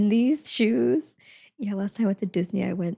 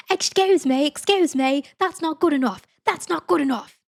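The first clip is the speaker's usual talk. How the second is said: higher, louder mock British complaint; hammed-up outraged Brit in a bad accent; bad British yelling; 6 semitones higher, 3 dB louder than usual. Shown as a demonstration. Viewer's line for failing teammates, loud bad British accent. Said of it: "make sure you yell".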